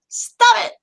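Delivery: high, sharp outburst; mock scolding. Host telling players to quit posting, mock scolding. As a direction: burst out in playful exasperation.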